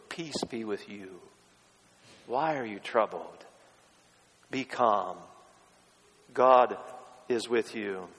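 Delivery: soft, low, unhurried words of comfort; calm a troubled heart, softly and with pauses; tender reassurance. Acted. Voicing Jesus's first words of comfort.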